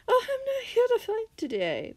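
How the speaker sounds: high, put-on innocent voice; mock innocent. A narrator's reading. Villain disarms the escorts, mock innocent. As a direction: play harmless in a high voice. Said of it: "the man said innocently".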